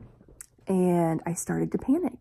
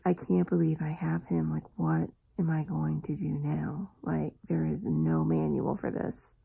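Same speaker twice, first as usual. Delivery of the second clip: inner doubt; 2 semitones lower, 5 dB quieter than usual. Retold or imitated. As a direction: voice an anxious thought under your breath. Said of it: "there was a part of me that's like".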